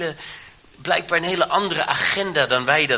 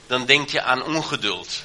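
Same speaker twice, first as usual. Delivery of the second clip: ordinary talk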